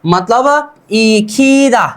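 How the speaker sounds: इखीदा said slowly, each syllable clear; slow pronunciation demo. Shown as a demonstration. Teacher to students, slowly sounding out इखीदा. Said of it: "सीधा सीधा क्या होगा".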